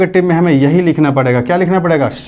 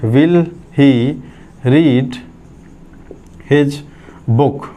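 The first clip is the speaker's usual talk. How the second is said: words spaced by long pauses; slow dictation; 2 semitones lower, much slower than usual. Shown as a demonstration. Teacher dictates to class, word by word. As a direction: read it out slowly to copy down. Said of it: "dictating the wrong version".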